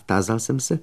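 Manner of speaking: ordinary delivery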